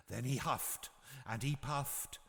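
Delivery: ordinary delivery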